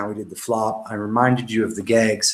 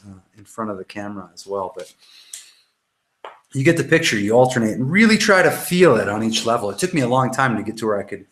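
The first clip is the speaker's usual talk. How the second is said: ordinary talk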